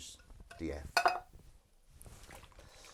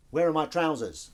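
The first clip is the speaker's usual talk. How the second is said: loud, raised demo cue; cue line aloud; 5 semitones higher, 9 dB louder than usual. Shown as a demonstration. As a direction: project the other actor's line. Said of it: "You'd, you'd record".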